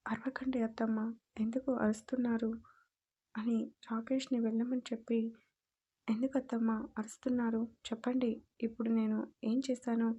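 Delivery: usual speaking voice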